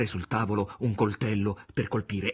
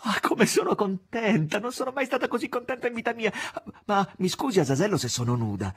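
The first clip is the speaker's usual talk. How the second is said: high and breathless with joy; overjoyed exclamation; 12 semitones higher, 4 dB louder than usual. Acted. Margherita welcoming Azazello, high and overjoyed. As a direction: gush with delight. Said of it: "Margherita esclamava".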